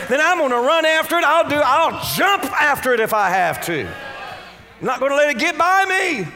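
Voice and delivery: high, loud, building preacher's cry; determined declaration. Acted. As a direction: declare it with rising force, refusing to let it pass. Preacher voicing a determined receiver.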